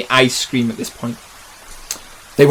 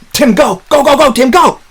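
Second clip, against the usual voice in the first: high, loud, rising urgency; urgent shout; 9 semitones higher, 4 dB louder than usual. Acted. Man urging Tim down the tree from a falling car, urgent shout. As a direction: yell to get him moving.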